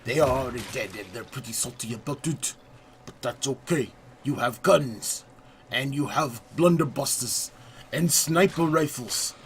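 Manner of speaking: playful, hammed-up French accent narrating the pirate adventure; a self-confessed terrible French accent, overplayed for laughs; mock French accent. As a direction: put on a deliberately bad French accent, like a cheesy pirate guide. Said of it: "I have a terrible French accent".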